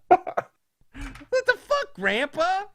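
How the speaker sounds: very high, drawn-out disbelief; high-pitched appalled reaction; shocked outrage. Retold or imitated. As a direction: squeal it slowly in mock outrage. Reacting in outrage to grandpa's blurt.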